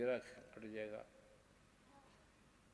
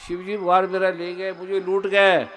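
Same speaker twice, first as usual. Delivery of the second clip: loud, higher lament of the bereaved; mock wailing; 5 semitones higher, 17 dB louder than usual. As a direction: wail it out as if all are dead. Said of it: "और जोर से चीखो". (ज़ोर से विलाप करते हुए)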